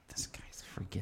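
whispered, half to herself; whispered aside retelling a creepy film moment; whispered reaction. Retold or imitated. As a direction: hush it like you're unnerved in the dark. Retelling reaction while watching.

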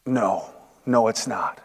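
low, soft, downcast admission; reluctant honesty. Acted. Man admits to the Lord the gold axe isn't his, reluctant. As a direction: turn it down quietly, with a heavy pause.

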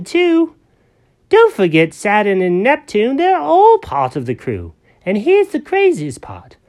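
party host kitty. Acted. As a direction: gush like a proud little birthday cat. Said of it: "He goes".

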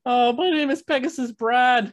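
high-pitched comic character voice; Pegasus Brad. Acted. Co-host introducing his character to amuse his friend, high put-on voice. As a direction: introduce yourself in a high, silly voice. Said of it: "I love this character".

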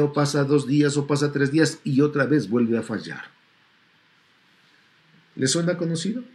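ordinary talk, nothing marked

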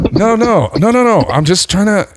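high, panicky mock pleading; flustered protest. Retold flustered denial to accuser.

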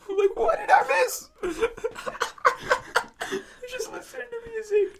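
very high squeal through laughter; real laughing. Real, not acted. Joking about missing it with headphones on, helpless laughter. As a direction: crack up while talking, voice shooting up. Cracks up voicing the confused listener.

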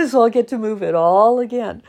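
high laugh spilling into the words. Real, not acted. Joking to viewers about moving everything twice, laughing.

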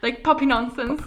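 mocking politician in British accent; Boris Johnson British accent. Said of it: "I like your British accent".

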